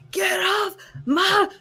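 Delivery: high, loud, very drawn-out Scottish ogre yell; Shrek. Acted. Mocking Shrek's idea of Scottish people, hammed-up yell. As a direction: holler it slowly in an exaggerated Scottish accent. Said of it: "people aren't going around going".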